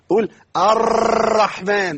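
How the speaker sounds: الرحمن said slowly with a trilled raa; rolled raa. Retold or imitated. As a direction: imitate someone rolling الرحمن. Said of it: "دي الراء مكررة".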